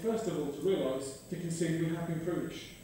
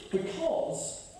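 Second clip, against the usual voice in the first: ordinary delivery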